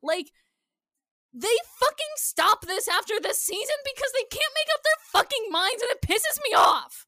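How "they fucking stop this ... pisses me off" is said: loud, high exasperated rant; angry rant. Real, not acted. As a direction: vent your frustration loudly. Ranting about inconsistent naming.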